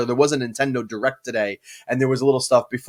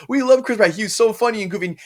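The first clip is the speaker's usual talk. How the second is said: higher-pitched imitation of adoring fans; exaggerated fan adoration, pitched up; mock gushing; 8 semitones higher than usual. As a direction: gush like a fickle fan. Mimicking fans who gushed over him.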